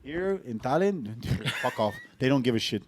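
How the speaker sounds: usual speaking voice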